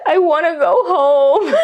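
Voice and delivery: high, teary imitation of her own plea; tearful plea. A patient tells the nurses she wants home, in a mock teary voice. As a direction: replay her own sob, half laughing. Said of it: "laughs cry".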